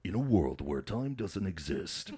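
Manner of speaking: slow, epic trailer announcer; movie trailer voice. Shown as a demonstration. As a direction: intone it like a blockbuster trailer. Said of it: "the movie trailer guy".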